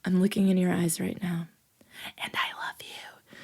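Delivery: soft intimate whisper; serious. Shown as a demonstration. Teacher contrasting serious love declaration, hushed whisper. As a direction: whisper it like a solemn confession. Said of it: "like, serious and saying".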